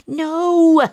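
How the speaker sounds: loud high cry of no; anguished shout. Acted. Detective loses the van and yells in despair, anguished shout. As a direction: yell out your frustration. Pounding fist on the dash.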